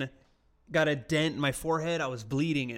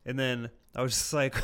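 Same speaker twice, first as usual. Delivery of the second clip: usual speaking voice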